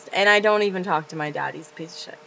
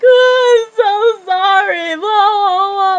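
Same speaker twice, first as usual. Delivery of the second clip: high, loud, slow fake-crying wail; hammed-up teary apology; crackhead wail; 13 semitones higher, 10 dB louder, much slower than usual. The crazy friend apologizing, high, loud fake-sobbing wail. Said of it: "acting like a fucking crackhead".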